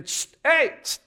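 loud attention-getting psst and hey; calling out. Acted. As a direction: hiss for attention, then a high loud hey. Acts Booz calling her over.

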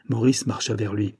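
ordinary delivery, nothing marked

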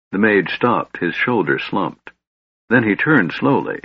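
ordinary delivery

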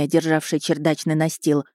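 plain reading voice

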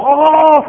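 high yelp of pain, loud; scream of pain. Retold or imitated. The struck man in the story, screaming in pain. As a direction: cry out as if struck on the head. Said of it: "أخذ الرجل يصرخ".

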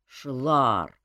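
reproachful calling of a name. A narrator's reading. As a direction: chide him by name. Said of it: "укоризненно отозвался закалённый кузен".

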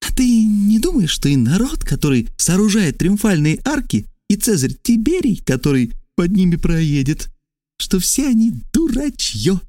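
high, voiced mock whisper; conspiratorial stage whisper. A narrator's reading. Trasul leans to the stranger's ear, high conspiratorial voice. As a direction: confide it in a high character voice. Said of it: "он зашептал".

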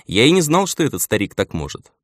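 ordinary delivery

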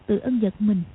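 ordinary talk, nothing marked

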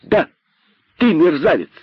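high, cheeky confirmation; gleeful shout. A narrator's reading. Cheeky rabbit taunts the python, gleeful. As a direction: throw it back joyfully, pitch up. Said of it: "радостно закричал дерзкий кролик".

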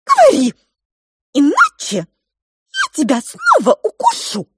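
very high, loud squeaking threat; squeaky mouse threat. Acted. Pink mouse threatening the cook, very high and loud. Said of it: "перешла к угрозам".

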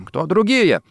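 feigned incomprehension. A narrator's reading. Rossetti pretends not to understand, innocently puzzled. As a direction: play innocent, pitch lifted. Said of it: "Сделал вид, что не понял вопроса".

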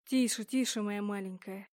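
soft, hushed comfort; soothing whisper. A narrator's reading. Inquisitor comforting sobbing Mia, soft tender hush. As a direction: lull her like a child.

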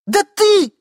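very high, loud retort; high-pitched impatient woman; exasperated wife. Acted. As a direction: shriek it at a dim husband. Wife impatient with forgetful husband.

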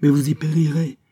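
high, loud warning; alarmed exclamation. A narrator's reading. Courier warns Biot of the danger, exclaiming. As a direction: cry out to hold them back. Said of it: "s'écria le courrier".

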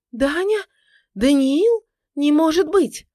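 high, loud disbelieving cry; shocked recognition. A narrator's reading. Woman recognizing her supposedly dead husband, shocked cries.

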